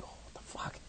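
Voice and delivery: breathy whisper of disbelief; whispered shock. Retold or imitated. Teller whispering his own shocked reaction. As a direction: mouth it under your breath, stunned. Startled by a vision, thinking aloud.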